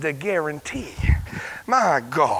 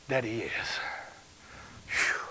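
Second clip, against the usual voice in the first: much lower and softer; quiet, awed repetition; hushed awe; 7 semitones lower, 10 dB quieter than usual. Real, not acted. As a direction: let it fall to a reverent hush. Preacher drops to hush.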